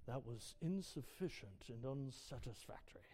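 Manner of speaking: low, hushed boss's verdict; critical boss. Acted. Preacher voicing a boss reviewing your work, low and disapproving. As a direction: deliver the verdict softly and very low. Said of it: "had a boss tell you".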